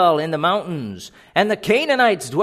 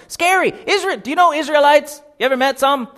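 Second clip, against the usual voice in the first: ordinary delivery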